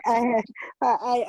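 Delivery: normal talking voice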